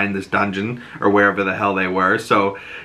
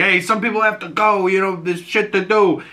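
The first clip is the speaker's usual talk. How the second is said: higher breezy mobster; 8 semitones higher than usual. Retold or imitated. Oz brushing off people as he leaves, breezy put-on voice. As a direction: toss it off casually. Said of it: "he's like".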